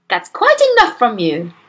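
loud, firm reprimand; indignant telling-off. A narrator's reading. Caught-out mother to daughter, indignant. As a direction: shut the child up firmly.

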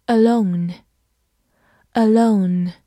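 ordinary delivery

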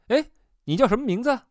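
high-pitched call after someone. A narrator's reading. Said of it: "我喊了一声".

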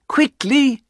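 high, eager command; urgent. A narrator's reading. Wife sends husband for paw, urgent. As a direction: urge it as if no moment can be lost.

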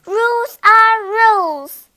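high, loud insistent mosquito; a tiny bossy insect laying down the law. A narrator's reading.

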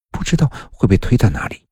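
plain reading voice

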